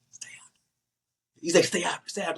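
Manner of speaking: near-silent mouthed warning; mouthed words. Retold or imitated. Retelling the man mouthing at him, mouthed. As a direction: barely voice it, just lips. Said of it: "He's mouthing it".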